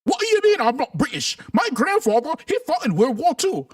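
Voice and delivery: hammed-up, high-pitched mock British indignation; bad British accent. Streamer mocking Brits boasting of a grandfather's war, bad British accent. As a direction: play an affronted Brit with a poor accent. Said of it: "I don't have a good British accent".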